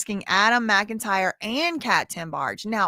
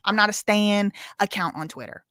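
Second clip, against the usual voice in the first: normal talking voice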